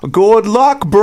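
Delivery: put-on Aussie bloke greeting, Australian accent; outback bushman. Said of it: "Pourrais-tu le faire avec l'accent australien?".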